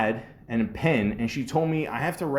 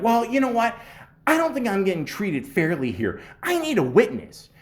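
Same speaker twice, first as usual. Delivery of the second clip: 1 mock huffy boss; 9 semitones higher than usual. Mimics boss demanding witness.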